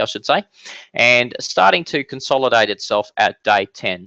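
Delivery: normal talking voice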